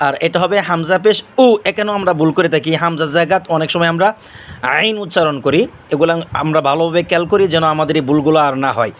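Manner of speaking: ordinary talk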